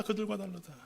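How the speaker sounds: quiet echo of the line; soft echo. Acted. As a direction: let 그들과 다르다 fall away quietly. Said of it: "그 마음이 그들과 다르다".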